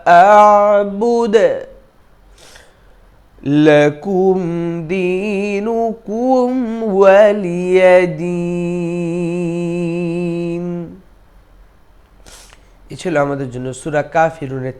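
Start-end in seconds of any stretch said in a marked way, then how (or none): 0.1-10.6 slow, melodic tajweed chant with long held vowels; Quran recitation. Acted. Teacher recites the surah for students in slow chant. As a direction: chant each word slowly, drawn out.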